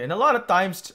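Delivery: Filipino accent slipping out. Real, not acted. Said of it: "my Filipino accent come up there".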